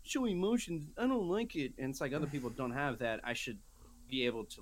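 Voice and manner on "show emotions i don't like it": high-pitched mock complainer; mock whining. Man mocking his first world problems, high mock whine. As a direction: put on a high self-mocking voice.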